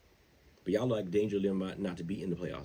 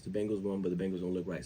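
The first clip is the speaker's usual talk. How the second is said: ordinary delivery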